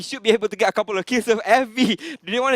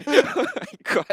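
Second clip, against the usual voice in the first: high, slow laugh-cry; 12 semitones higher, slower than usual. Real, not acted. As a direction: wheeze it out through laughter. Caster overcome by laughter.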